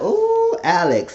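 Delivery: high teasing ooh; a high teasing call to the character. Real, not acted. To Alex on screen, a high teasing ooh. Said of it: "So I said".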